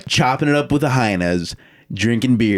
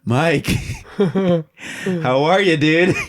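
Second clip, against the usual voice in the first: high, slow laughing hello; laughing nervous greeting; 9 semitones higher, much slower than usual. Retold or imitated. Friends greeting bearded Mike, high and laughing. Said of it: "And we're like".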